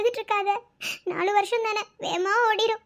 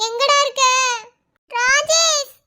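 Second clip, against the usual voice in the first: calling out; 2 semitones higher, 5 dB louder, slower than usual. Acted. Calling out for missing Rajesh.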